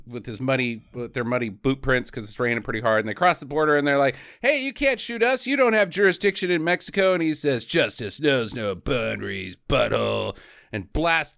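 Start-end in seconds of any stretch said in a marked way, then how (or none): 4.4-7.1 higher, quick taunting voice; mock outlaw protest. Outlaws to the lawman at the border, high mock protest. 7.7-10.4 slow, slightly lowered hero line; a hint of grim lawman; mock tough lawman. Lawman answering fleeing outlaws, slow mock-heroic reply.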